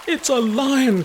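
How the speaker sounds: high, loud frightened cry; cry of fright. Acted. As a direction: scream it in panic. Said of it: "cried Fernand in fright".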